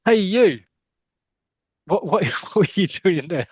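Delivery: hammed-up call at a snooper. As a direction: hail the snooper across the room. Calling out to the intruder again.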